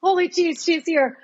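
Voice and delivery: high-pitched overwhelmed wonder; imitated amazement. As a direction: marvel that she has arrived. Retelling first sight of her baby.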